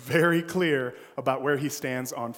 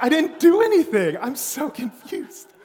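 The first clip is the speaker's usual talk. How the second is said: high, loud protest, slowing at the end; whiny bewildered child; confused kid; 10 semitones higher, 9 dB louder than usual. Retold or imitated. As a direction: plead innocence as a wronged boy. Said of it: "And I was like".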